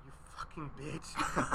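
slow, soft whispered curse; whispered insult. Retold or imitated. To his laughing girlfriend, whispered slowly. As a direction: hiss it at her under your breath. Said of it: "whispering to her".

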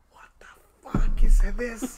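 slow, drawn-out, breathy outburst building loud; retold rage. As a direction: relive the rage, stretching each word. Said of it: "going into, like, rage mode".

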